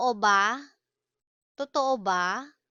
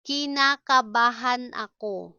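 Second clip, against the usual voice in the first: deliberate, syllable by syllable; 3 dB louder than usual. Shown as a demonstration. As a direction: break the long word up for learners. Slow model, then natural speed.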